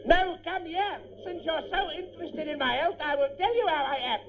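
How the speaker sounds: high-pitched, agitated indignation; rising to the bait, voice pitched high; worked up. Acted. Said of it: "Don't get so worked up like that".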